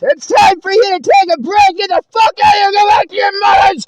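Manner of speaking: loud, high-pitched enraged shouting; furious screaming. Retold or imitated. Relatives fight and order the teller out, furious screaming. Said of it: "they're kicking and screaming".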